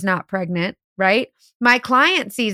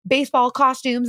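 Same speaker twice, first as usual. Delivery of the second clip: usual speaking voice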